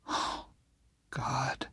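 ordinary delivery, nothing marked

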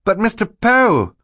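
high, loud, drawn-out protest; indignant. A narrator's reading. Klaus protests to Mister Poe, indignant. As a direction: object with a wail on "Poe". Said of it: "Klaus said indignantly".

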